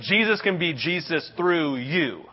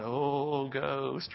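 spooked hush; 3 semitones lower, 10 dB quieter than usual. Acted. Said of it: "someone's sitting there going".

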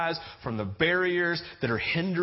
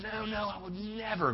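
higher, softer eager denial; Peter's protest; 6 semitones higher, 7 dB quieter than usual. Retold or imitated. Peter insisting to Jesus, eager protest. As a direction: play Peter swearing he'd never.

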